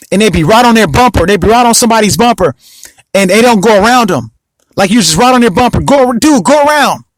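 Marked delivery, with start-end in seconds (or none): 5.8-7.0 high, fed-up urging; exasperated. Retold or imitated. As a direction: push the tailgater to pass. Frustrated at tailgater.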